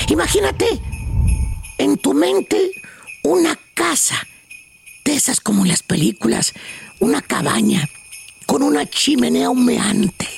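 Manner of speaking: slow, low meditation voice; guided meditation. Acted. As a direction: lead a calm Christmas visualization slowly. Leads a mock meditation.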